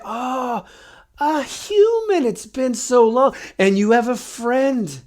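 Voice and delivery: put-on high, slow, delighted old voice; curious mummy. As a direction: pitch it way up, savouring each word. Mummy notices the party.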